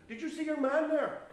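high, loud, urgent question; agitated question. Retold or imitated. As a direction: ask it loudly, still rattled. Asking friend after the scare.

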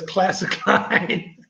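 drawn out slowly through a laugh; amused, very slow quote; laughing catchphrase. Real, not acted. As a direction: stretch it out, breaking into breathy laughter. Amused, quoting a friend's catchphrase.

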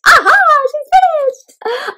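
high, slow delighted exclaim; excited cry. Acted. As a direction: shout it like a eureka. Cookie finishes the poem.

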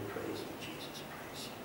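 breathy hushed whisper of worship; whispered praise. Real, not acted. Pastor murmuring praise to God mid-prayer, whispered. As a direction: breathe the praise almost silently.

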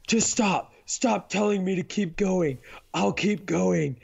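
strained mock yell, stretching the words; fed-up runner's plea, retold; exasperated wife. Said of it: "she's sitting there yelling at me".

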